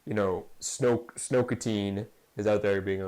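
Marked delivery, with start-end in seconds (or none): none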